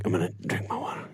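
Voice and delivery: fast whispered aside; ASMR whisper. Real, not acted. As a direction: whisper it close to the mic. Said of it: "This is now an A S M R podcast".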